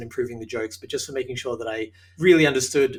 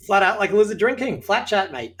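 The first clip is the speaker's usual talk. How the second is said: higher, louder Australian burst of slang; broad Australian slang; 7 semitones higher, 4 dB louder than usual. Pretend. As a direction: rattle off the Australian slang with hammed-up gusto. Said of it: "just uses nonstop Australian colloquialisms".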